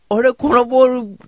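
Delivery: loud mock teasing. Teasing Tifa by grabbing her head, loud and high mock innocence. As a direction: ham up mistaking her head for a ball. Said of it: "ティファちゃんの頭をこうギュッと握る".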